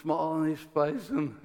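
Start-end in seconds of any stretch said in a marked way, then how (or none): none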